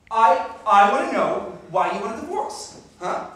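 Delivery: loud, rising to a high cry; demanding shout. Acted. Husband shouting at his wife to know why. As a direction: demand the answer from her.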